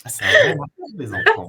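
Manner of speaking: high, loud burst through laughter; amused laughter. Real, not acted. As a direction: laugh while saying it, loudly. Both laugh about the children question. (en riant, voix aiguë et forte)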